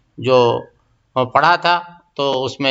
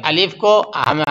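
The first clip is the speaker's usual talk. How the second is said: usual speaking voice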